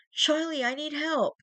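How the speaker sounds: high, loud panicked wail; wailing scream. A narrator's reading. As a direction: cry out for help, terrified. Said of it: "I wailed".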